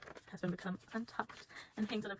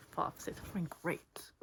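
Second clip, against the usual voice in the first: usual speaking voice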